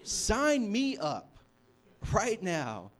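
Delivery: loud, drawn-out, high-pitched declaration; eager shout. Acted. Preacher volunteering for that mission trip, eager shout. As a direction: call it out slowly and emphatically.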